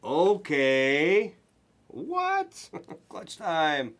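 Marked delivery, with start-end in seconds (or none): 1.9-2.7 a sudden high yelp of disbelief; shocked what. Real, not acted. Breaker stunned by the card, high yelp. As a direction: jolt up in pitch, stunned.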